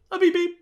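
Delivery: high, light little beep-beep; imitated courtesy beep. Shown as a demonstration. Host demonstrating a courtesy tap, high imitated beep. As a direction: mimic a polite tap on the car horn. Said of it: "This is a courtesy tap".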